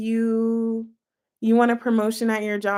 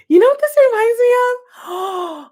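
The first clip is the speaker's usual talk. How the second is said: excited outburst; 11 semitones higher, 6 dB louder than usual. Real, not acted. Sudden excited realization.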